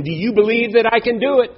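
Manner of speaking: high, fast showman's call to the crowd. Acted. Showman asking the crowd if he can do it. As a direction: call it out like a circus barker.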